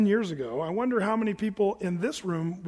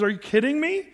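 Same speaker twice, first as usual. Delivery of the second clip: incredulous rebuke; 6 semitones higher, 3 dB louder than usual. Retold or imitated. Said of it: "He says".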